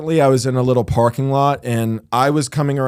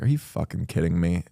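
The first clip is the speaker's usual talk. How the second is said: softer, lower, said half to himself; passive-aggressive mutter; 8 semitones lower, 9 dB quieter than usual. Retold or imitated. To a passenger with shoes up, soft passive-aggressive grumble. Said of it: "passively aggressively say something at a kind of normal volume".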